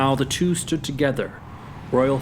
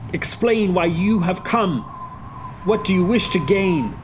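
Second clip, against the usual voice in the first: higher, insistent address; controlled resolve; 6 semitones higher than usual. Acted. Hamlet addressing his father's spirit, taking control. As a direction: demand answers with command. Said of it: "he swiftly took control".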